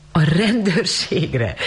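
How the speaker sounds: high, drawn-out incredulous question; amused exclamation. A narrator's reading. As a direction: echo it slowly, mildly amused. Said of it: "Rosemary felkacagott". (derűs csodálkozással)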